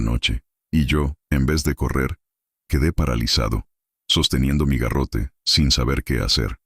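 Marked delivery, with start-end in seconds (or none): none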